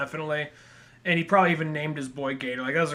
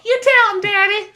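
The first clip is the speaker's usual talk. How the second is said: high, loud jeering cheer; hammed-up high cheering; mock cheer; 9 dB louder than usual. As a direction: egg him on with a shrill whoop. Cheering the dad on.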